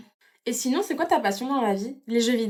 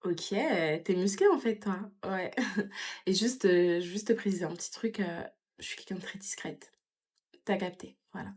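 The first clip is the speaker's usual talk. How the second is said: hushed flirting; 8 dB quieter than usual. Acted.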